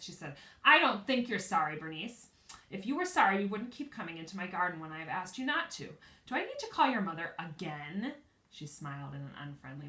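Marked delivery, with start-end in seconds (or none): 0.6-8.1 scolding. A narrator's reading. Angry neighbor scolds child.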